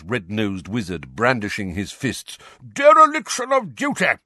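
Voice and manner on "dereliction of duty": high, loud indignant shout; outraged shout. A narrator's reading. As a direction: bellow the accusation. Portraits protest loudly.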